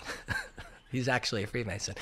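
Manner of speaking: laughing. Real, not acted. Laughing about friend Freemason.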